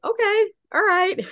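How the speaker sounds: high, mock agreeing okay; lightly voiced celebrity okay; amused agreement, mimicked. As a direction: imitate him going along with it. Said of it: "He's like".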